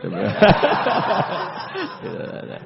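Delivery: high burst of laughter; high cracking laugh. Real, not acted. Preacher laughing at Umar's cheek, a high burst of laughter. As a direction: crack up high at your own story.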